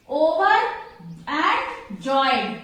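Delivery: Over and joyed in separate pieces; syllable by syllable. Shown as a demonstration. Teacher showing the word's parts to students, broken apart. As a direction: break it up, pausing before joyed. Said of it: "pronounced this word in two syllables".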